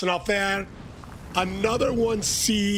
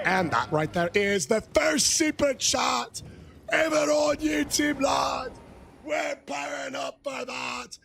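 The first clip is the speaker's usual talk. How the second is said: rally the whole stream for the toast; hype toast shout; 6 semitones higher than usual. Real, not acted. Hyping a livestream toast.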